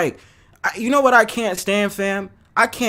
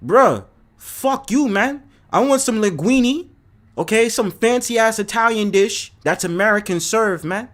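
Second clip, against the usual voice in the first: mock demanding woman; 3 semitones higher than usual. Woman demanding fancy Italian from her date, mock bossy. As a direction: demand linguine like a spoiled date. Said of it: "I'm like".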